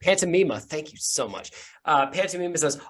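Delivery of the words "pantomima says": ordinary talk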